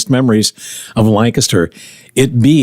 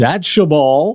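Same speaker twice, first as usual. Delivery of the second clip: baseball announcer voice; 3 semitones higher, slower than usual. Pretend. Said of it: "the baseball announcer voice".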